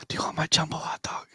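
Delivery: fast giggly whisper; movie theater whisper. Pretend. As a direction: tease in a sly fast whisper. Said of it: "you can whisper in the movies".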